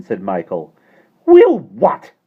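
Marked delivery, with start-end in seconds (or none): 1.3-2.1 high, loud haughty snap; haughtily. A narrator's reading. Landlady to Michael, haughty and sharp. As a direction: look down your nose at the boy. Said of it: "said Miss Persimmon haughtily".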